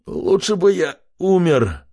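slow groaning lament. A narrator's reading. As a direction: moan it out in misery. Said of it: "простонал он".

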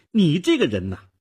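put-on high, loud scolding girl; stern accusation. A narrator's reading. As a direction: rebuke him sharply. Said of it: "少女厉声指责着". (厉声指责)

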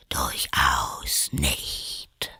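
low, slow whispery drawl; sleepy caterpillar. A narrator's reading. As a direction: contradict drowsily, half whispered. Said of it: "mit schläfriger, langsamer Stimme". (tief, langsam und schläfrig gehaucht)

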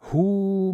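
high, loud drawn-out "hooo"; drawn-out "hoo". A narrator's reading. Two people tussling over the blanket, playful high "hoo". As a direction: let out a long, high "hoo". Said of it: "machten beide".

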